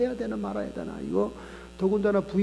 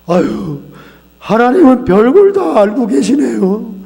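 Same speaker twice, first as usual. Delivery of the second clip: loud, slow anguished outcry with "아유"; husband breaking down, crying out loudly to the pastor; 5 semitones higher, 16 dB louder, slower than usual. Retold or imitated. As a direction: wail it out slowly as a weeping confession.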